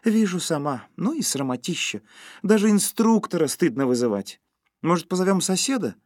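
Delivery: high, vexed wifely voice; exasperated. A narrator's reading. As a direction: cut him off in frustration. Wife exasperated at failed dish.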